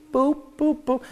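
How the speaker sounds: high squeaky boop noises; playful high-pitched boops; boop sound effect. Retold or imitated. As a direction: chirp each drop like a tiny squirt. Mimicking tiny ketchup drops.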